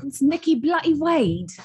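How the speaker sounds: put-on high-pitched British accent, quoting a TV line; heightened mock-British delivery. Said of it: "Can you do your British accent?".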